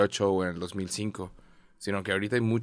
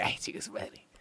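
breathy whispered aside; 13 dB quieter than usual. Real, not acted. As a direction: mutter it under your breath. Aside reacting to the messages.

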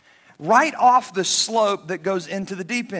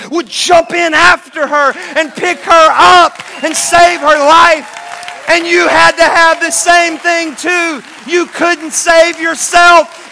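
high, loud, slow preaching; full-force preaching; 6 semitones higher, 7 dB louder, slower than usual. Acted. Preacher drives home that God came in after you, loud and impassioned. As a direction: build the sermon to its climax.

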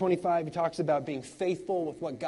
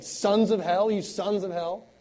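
mock denunciation; 5 semitones higher, 6 dB louder, slower than usual. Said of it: "Jesus used expressions like".

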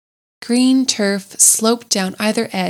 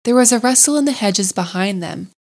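usual speaking voice

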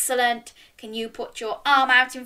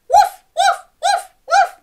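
very high, loud little yaps; dog woofs; 10 dB louder than usual. Acted. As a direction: yap like an excitable little dog. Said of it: "join in with all of these".

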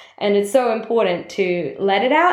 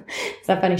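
ordinary talk

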